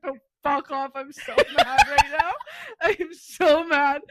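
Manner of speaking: loud mock fury, breaking into a laugh; emphatic, hammed-up outrage, slowing at the end; retold anger. Girlfriend rejects boyfriend's apology, mock furious.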